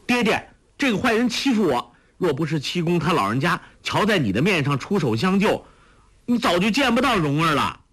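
put-on high, fast girlish complaint; complaining daughter. A narrator's reading. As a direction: tattle to daddy, quickly. Huang Rong complains to father.

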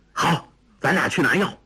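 a touch of bold resolve; resolute. A narrator's reading. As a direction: say it with sudden courage. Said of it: "昂然说". (略带昂然，语气坚定)

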